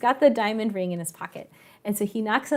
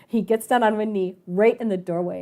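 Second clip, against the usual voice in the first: ordinary talk, nothing marked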